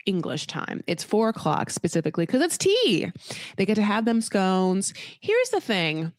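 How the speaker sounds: ordinary delivery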